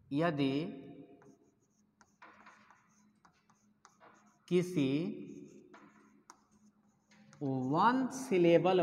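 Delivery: slow dictation, a word or two at a time; dictating. Real, not acted. Teacher dictating the first rule to students, slow broken-up dictation. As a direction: dictate softly with long pauses between phrases.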